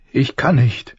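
high, weeping protest; tearful. A narrator's reading. Mother refusing her son's plea to sleep, tearful. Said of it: "weinte sie".

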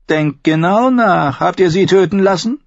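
Tyrion's voice. Acted.

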